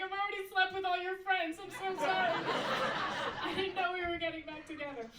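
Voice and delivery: high, loud hammed-up panic; frantic confession. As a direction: blurt it out in a mock frenzy. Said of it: "I'm like".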